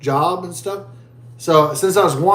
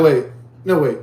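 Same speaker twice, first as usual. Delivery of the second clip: ordinary talk, nothing marked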